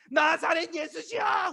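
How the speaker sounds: high, drawn-out call into the distance; acted blind beggar calling blindly; shouting out. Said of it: "예수님을 향해서 소리 지를 수가 있어".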